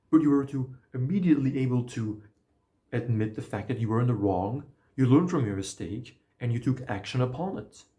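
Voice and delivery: British butler-like reply, lightly hammed up; British accent. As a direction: play the British clerk, eager to please. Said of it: "I have this in a British accent".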